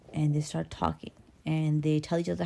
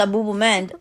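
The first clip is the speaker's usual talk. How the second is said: ordinary delivery, nothing marked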